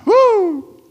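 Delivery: high, loud "woo"; exultant whoop. Real, not acted. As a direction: whoop for your winning team. Celebrating being on team Jesus.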